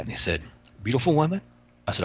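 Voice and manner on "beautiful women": higher-pitched imitation of an inmate; Love Kills impression. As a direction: imitate the inmate savouring his answer. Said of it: "I speak like he speaks".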